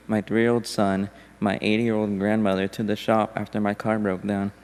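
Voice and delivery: ordinary delivery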